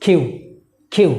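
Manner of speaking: কিউ with a puff of air on the k; correct pronunciation. Shown as a demonstration. Teacher modelling the correct letter name, aspirated k.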